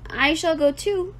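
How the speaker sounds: usual speaking voice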